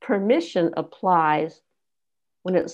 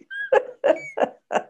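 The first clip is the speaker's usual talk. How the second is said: laugh. Real, not acted. Laughs after her soapbox.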